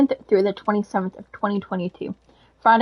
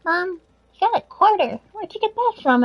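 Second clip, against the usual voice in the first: put-on much higher child voice; excited kid; 9 semitones higher than usual. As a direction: squeal like a little kid. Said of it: "Honey, look out".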